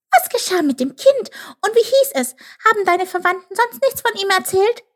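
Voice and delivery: high, impatient little voice; impatient call. A narrator's reading. Chip pesters buffalo about the child, impatient high call. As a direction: fire off the questions in a high squeak. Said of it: "rief Chip ungeduldig".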